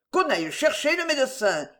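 high, urgent call; crying out. A narrator's reading. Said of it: "criait don Martine".

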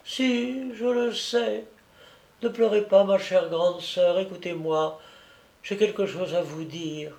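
put-on a sick child comforting his big sister; dying little boy. Dying child speaks, held voice. (petit garçon mourant, voix d'enfant douce et fragile)

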